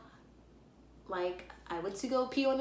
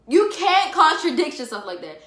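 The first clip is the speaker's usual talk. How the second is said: indignant; 8 semitones higher, 12 dB louder than usual. Real, not acted. Indignant at mom's answer.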